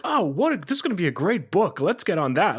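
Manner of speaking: high-pitched, gushing mock enthusiasm; mock excitement. Host imagining an eager author spotting a great book, mock excited. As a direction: imitate someone thrilled by a book idea.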